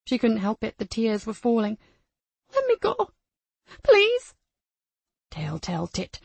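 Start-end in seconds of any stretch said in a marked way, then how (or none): 2.5-4.3 high, loud, tearful plea; terrified child begging. Acted. Crying child begs. 5.3-6.2 low, soft sinister taunt. Acted. Abuser taunting child.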